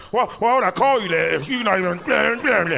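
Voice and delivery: high, loud rant dissolving into blah-blah; mock argument. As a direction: imitate an indignant person losing the words. People mad about his name, acted.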